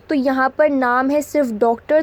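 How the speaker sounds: normal talking voice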